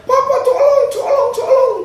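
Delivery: high, loud cry for help; imitated child's scream. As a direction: scream for Papa like a panicked child. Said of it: "Artinya anaknya teriak". (menirukan anak menjerit minta tolong)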